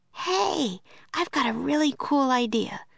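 put-on high eager girl voice; Annie with an idea. A narrator's reading. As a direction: pitch up like a girl with a plan. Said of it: "said Annie".

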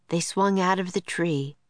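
plain reading voice

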